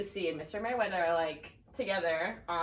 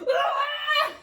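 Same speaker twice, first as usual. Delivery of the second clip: very high, loud laughing cry of pain; overdone pain shriek; hammed-up fake yelp; 10 dB louder than usual. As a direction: yelp like a bad actor in pain. [name] fakes a headache.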